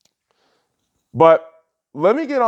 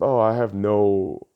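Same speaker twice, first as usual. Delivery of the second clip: much lower, softer, droning mock of Steven Crowder; 9 semitones lower, 5 dB quieter than usual. Vlogger mocking Steven Crowder's claim of no beliefs, low and droning. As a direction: mumble it low like a dismissive pundit. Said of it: "Steven Crowder was getting on people with".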